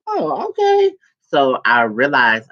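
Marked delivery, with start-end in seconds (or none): 0.1-0.9 much higher, impressed oh okay; mock surprise. Mom watching men hit on her daughter, surprised much higher. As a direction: play mom, amused and impressed. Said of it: "my mama looking like".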